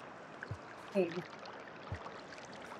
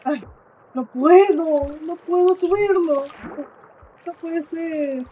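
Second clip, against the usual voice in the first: frustrated whine; 5 semitones higher, 4 dB louder, much slower than usual. Real, not acted. Stuck in the game, frustrated.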